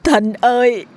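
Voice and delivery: put-on possessed teacher screaming for his mother in a girl's voice; wailing scream. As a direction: scream in terror for your mother. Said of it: "gào lên thảm thiết".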